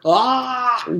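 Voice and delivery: high loud startled yell. Acted. Jason falling off the chair, yelling. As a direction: cry out as the chair tips. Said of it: "yelled Jason".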